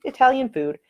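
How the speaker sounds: usual speaking voice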